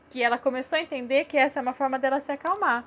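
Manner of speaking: normal talking voice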